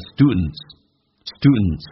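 slow "students" with no D before the N, "stu'nts"; "students" drawn out as "stu-nts"; D dropped. Shown as a demonstration. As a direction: swallow the D in "students", American style. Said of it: "有时候美国人会把D去掉".

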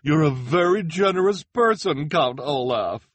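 high, loud gushing praise; cried out. Acted. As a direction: praise it like a thrilled reporter. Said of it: "the reporter cried".

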